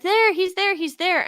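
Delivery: put-on high, excited child shriek; kids screaming at the screen. Kids shouting at Dora, playful mock excited child yell. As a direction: yell it like a kid spotting the villain. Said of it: "the kids would scream it".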